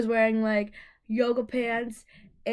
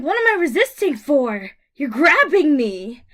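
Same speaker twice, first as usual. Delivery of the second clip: protesting loudly; 3 semitones higher, 8 dB louder than usual. Retold or imitated. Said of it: "I'm like".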